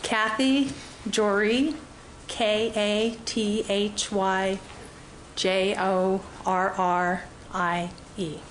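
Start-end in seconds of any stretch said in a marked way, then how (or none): 2.3-8.4 slow, letter-by-letter spelling; spelled letter by letter. Real, not acted. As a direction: spell out each letter slowly. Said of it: "Spell your first and last names".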